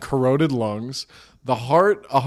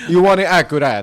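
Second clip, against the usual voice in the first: put-on Italian painter's accent; comic Italian accent, pushed louder; 3 semitones higher, 6 dB louder than usual. Painter to the king about the portrait, Italian accent. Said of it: "some Italian guy, like".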